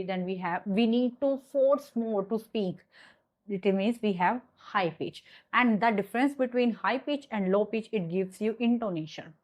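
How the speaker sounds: ordinary delivery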